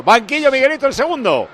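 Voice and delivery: high loud call to the bench. Real, not acted. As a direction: shout over to the bench. Calling out to the bench. (llamando al banquillo a voz en grito)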